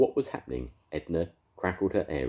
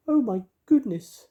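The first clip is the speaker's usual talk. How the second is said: very high, louder fairy wail; 9 dB louder than usual. A narrator's reading. Mavis wailing over the melting beast, high fairy voice. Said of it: "wailed Mavis".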